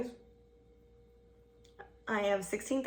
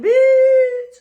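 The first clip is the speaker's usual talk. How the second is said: very high, blasting long shriek; hammed-up drawn-out yell; retold outcry; 18 dB louder than usual. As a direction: screech it in exaggerated mock disbelief. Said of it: "I said".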